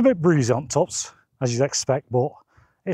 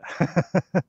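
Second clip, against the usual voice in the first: short breathy laugh; 5 semitones higher than usual. Real, not acted. Laughs signing off the clip.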